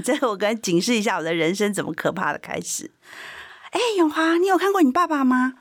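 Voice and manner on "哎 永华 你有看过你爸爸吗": high-pitched nosy neighbourhood uncle; 동네 아저씨. Retold or imitated. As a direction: call out high, like a local uncle prying. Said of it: "就我们那附近的阿伯告诉我".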